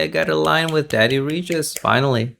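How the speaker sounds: ordinary talk, nothing marked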